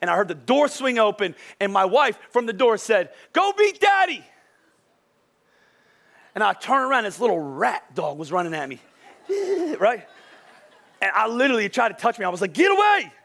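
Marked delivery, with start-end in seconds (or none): 3.3-4.2 high, loud singsong call; high-pitched mock wife's holler; imitated wife calling. Wife calls from the door. 12.5-13.1 high, loud retold yell; comic recoiling shout; startled shooing yell. Shooing off the rat dog.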